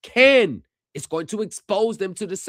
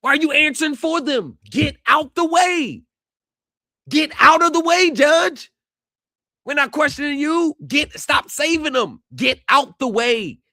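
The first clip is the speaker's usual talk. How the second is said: higher, louder, yelling at the judge in outrage; furious shout; 8 semitones higher, 6 dB louder than usual. Real, not acted. Streamer yells at the judge for answering for the lender, furious shout. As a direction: scream at him to get out of the way.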